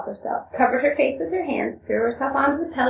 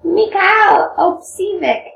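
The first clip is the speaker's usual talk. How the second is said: lightly raised, drawn-out character voice; slow, slightly higher storybook character; Grushenka; 9 semitones higher, 6 dB louder, slower than usual. Acted. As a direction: stretch the name out in a higher woman's part. Reads Grushenka's speech aloud.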